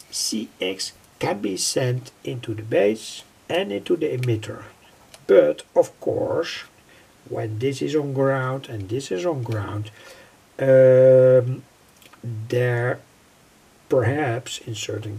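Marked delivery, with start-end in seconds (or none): none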